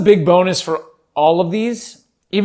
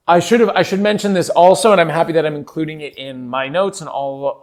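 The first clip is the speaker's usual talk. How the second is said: ordinary talk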